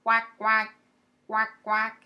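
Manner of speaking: loud quacks; a dog doing loud duck quacks; dog quacking. Acted. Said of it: "The dog says".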